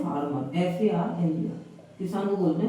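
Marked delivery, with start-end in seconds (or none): 0.0-0.6 फार्मर said low and clear; pronunciation demo. Shown as a demonstration. Teacher answering his own question, low. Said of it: "किसान को क्या बोलते हैं?". 0.6-1.6 एफ ए आर एम ई आर letter by letter, slow; spelling out. Shown as a demonstration. Teacher spelling the word for students, slowly.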